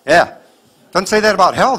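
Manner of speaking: usual speaking voice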